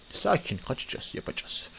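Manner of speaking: slow hushed whisper; slow whispered plan. Real, not acted. Sneaking plan in game.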